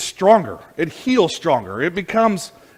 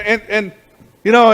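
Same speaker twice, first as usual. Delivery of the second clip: ordinary talk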